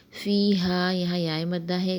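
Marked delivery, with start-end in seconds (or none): 0.1-0.9 فِيهَا with the yaa held one alif long; فِيهَا with a held fee; vowel length. Shown as a demonstration. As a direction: stretch فِيهَا by one count. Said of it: "ایک الف کے برابر پڑھیں گے".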